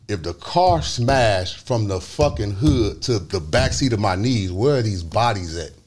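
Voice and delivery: ordinary talk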